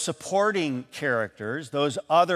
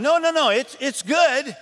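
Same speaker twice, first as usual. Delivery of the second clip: high, rushed no no no; flustered backpedal; 11 semitones higher, 3 dB louder than usual. Retold or imitated. Answerer scrambling after offending the cook, flustered and high. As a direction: hurry to take it back.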